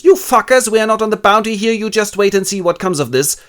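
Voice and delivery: loud, fast, ranting captain; furious captain. Acted. Captain cursing the mutineers, loud and fast. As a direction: rage at the mutineers. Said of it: "a little displeased and goes".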